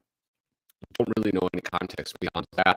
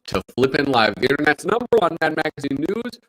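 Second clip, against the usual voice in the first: hype host intro; 7 semitones higher, 10 dB louder than usual. Acted. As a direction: open the show like an announcer. Host opening live stream show.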